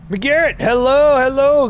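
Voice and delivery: high, loud sing-song hello; loud cheery greeting. Acted. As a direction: greet him brightly and loudly. Greeting a game character.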